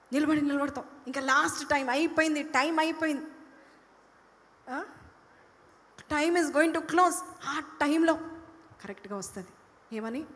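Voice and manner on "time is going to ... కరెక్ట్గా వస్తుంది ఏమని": ordinary delivery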